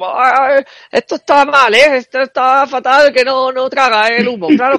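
high, loud mock host yelling from across the room; hammed-up alarmed host; sudden high squawk of alarm; alarmed party host. Imitating clueless party host.

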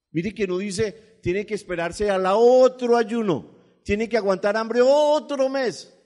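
loud, slow preacher emphasis; rising fervent sermon voice; preaching at full force. Acted. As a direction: drive each word home to the congregation.

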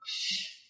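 a long, soft hushing shh to the audience. Shown as a demonstration. Speaker demonstrates the shh for the audience to copy, soft hush. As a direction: breathe out a quiet hiss to hush the room. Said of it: "Vuelven a hacer el shh".

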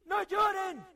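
high, loud frantic shouts; frightened player fleeing crying ghosts; panicked screaming. Real, not acted. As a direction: yell in terror while running off.

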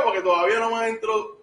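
ordinary delivery

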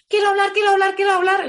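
voz de niña, más aguda y fuerte, insistente; niña pequeña impaciente. Retold or imitated. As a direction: pide hablar como una niña que no puede esperar. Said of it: "tu niña pequeña esté diciendo". (la niña interior de quien habla mucho, insistiendo con voz aguda y fuerte)

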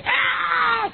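high-pitched mock scream; imitated scream. Preacher imitates a possessed vagrant seeing the prophet, mock scream. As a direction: yelp like someone spotting the prophet. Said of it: "cuando lo ve hace".

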